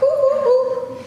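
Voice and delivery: high hooting monkey noises. Acted. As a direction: hoot like a playful monkey. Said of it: "my monkey voice".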